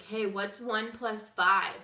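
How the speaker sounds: ordinary delivery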